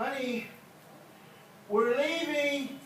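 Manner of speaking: drawn-out high call; calling out to wife. Acted. Preacher calling to his wife on the way out, drawn-out high call. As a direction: sing it out to the house. Preacher acts spouse leaving for church.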